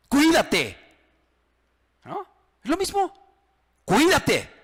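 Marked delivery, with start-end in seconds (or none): 0.1-0.8 "¡Cuídate!" barked loud and higher; threatening warning. Shown as a demonstration. Pastor shows a threat with the same word, loud and hostile. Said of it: "O puedo decirle a alguien". 3.8-4.5 "Cuídate" snapped loud and higher as a threat; threatening warning. Shown as a demonstration. Pastor contrasts the word as a loud menace. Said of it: "Es lo mismo, la misma palabra".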